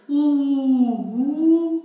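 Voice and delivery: long, high, loud "ooo" wail; spooky ghost howl. Shown as a demonstration. Teacher imitating horror movie sound for students, eerie ghostly howling. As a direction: hoot like a horror-film ghost. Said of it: "तो ये भयावह आवाज है".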